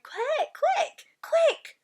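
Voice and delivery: frantic urgent. Acted. BFG urgent, laughing.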